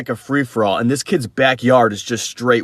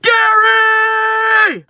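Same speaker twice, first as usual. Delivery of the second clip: screaming a name. Retold or imitated. Lost alone in the woods, calling for his buddy, full-throated shout. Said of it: "I just start screaming".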